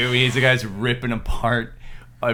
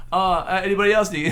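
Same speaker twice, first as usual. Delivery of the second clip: sheepish comic voice; 7 semitones higher than usual. Retold or imitated. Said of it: "he's like".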